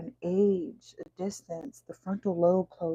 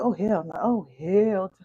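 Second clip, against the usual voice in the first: mock God, higher and louder, sassy; hammed-up God talking back, pitched up and loud; voicing God; 7 semitones higher, 6 dB louder than usual. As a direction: play God scolding the client, higher and louder. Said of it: "God said".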